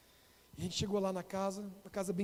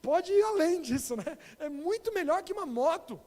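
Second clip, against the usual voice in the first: higher, louder, slower excited assurance; hyping up heaven to the boy, higher and eager; excited promise; 5 semitones higher, 5 dB louder than usual. Retold or imitated. Excited pastor talking to a child about heaven. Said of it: "Eu falei".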